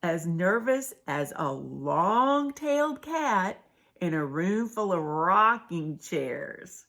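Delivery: slowly repeats the phrase for emphasis. Shown as a demonstration. Storyteller repeating the simile slowly for kids. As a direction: lay it out slowly so they catch it. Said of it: "Did you catch that?".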